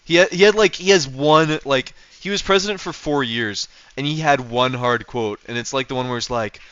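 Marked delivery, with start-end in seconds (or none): none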